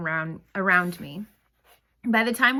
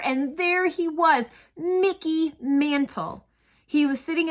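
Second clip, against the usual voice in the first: awestruck; 8 semitones higher, slower than usual. A narrator's reading. Awed reveal of hero.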